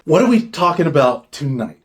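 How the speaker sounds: ordinary delivery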